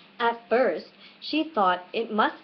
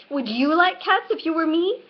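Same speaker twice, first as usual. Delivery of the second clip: higher, louder offended question; indignant mouse; 7 semitones higher, 4 dB louder than usual. A narrator's reading. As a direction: challenge her, still stung. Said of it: "shrill, passionate voice".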